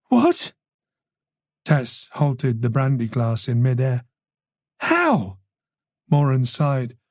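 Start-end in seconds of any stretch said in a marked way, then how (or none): 0.1-0.5 sharp high startled what; shocked. Acted. Tess hearing the killer escaped, shocked high cry. 4.8-5.4 sharp high startled what; shocked. Acted. Tess hearing the killer escaped, shocked high cry.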